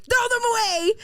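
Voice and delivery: high, loud hammed-up warning; mock yelling. Women in videos warning about Pisces men, mock yelling. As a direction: imitate alarmed women shouting advice.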